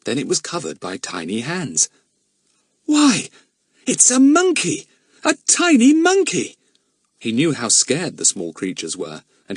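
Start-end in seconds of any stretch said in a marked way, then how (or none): 2.8-6.6 high, loud delighted surprise; surprised exclamation. Acted. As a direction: gasp it out in wonder. Child voiced in story, surprised.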